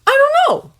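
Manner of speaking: put-on young man shouting in frustration during an argument; shouted. A narrator's reading. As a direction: throw the words out in exasperation. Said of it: "Harry shouted".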